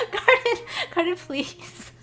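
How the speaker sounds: high, loud words through real laughter; laughing hard. Real, not acted. Streamer reacting to First Garden's message, laughing helplessly. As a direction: plead while cracking up.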